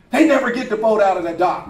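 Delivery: normal talking voice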